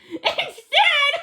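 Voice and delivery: high, squealing with laughter; can't stop laughing. A narrator's reading. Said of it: "She started to laugh".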